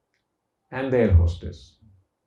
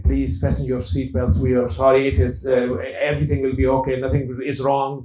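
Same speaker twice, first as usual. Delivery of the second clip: shaky pilot voice; 3 semitones higher, 6 dB louder than usual. Retold or imitated. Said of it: "But his own voice was shaking".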